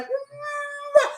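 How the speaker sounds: high closed-mouth hum, mouth full of water; muffled mm-hmm. Retold or imitated. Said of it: "And she's like".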